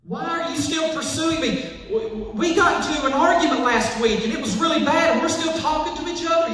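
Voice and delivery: high-pitched mock disbelief; puzzled questioning. Acted quote of puzzled people.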